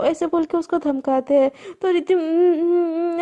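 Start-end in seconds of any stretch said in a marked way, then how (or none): 2.1-3.2 put-on gagged girl straining, mumbling um um; muffled cries through a gag. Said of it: "खुद को छुड़ा रही होती है". (बंधे मुंह से घुटी आवाज़)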